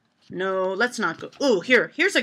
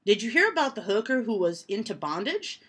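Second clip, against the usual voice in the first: ordinary talk, nothing marked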